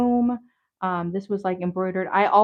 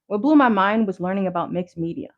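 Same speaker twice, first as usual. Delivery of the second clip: ordinary talk, nothing marked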